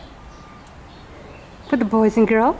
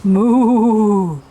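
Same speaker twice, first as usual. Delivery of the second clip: cow moo; 4 semitones lower than usual. Shown as a demonstration. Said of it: "What does the cow say?".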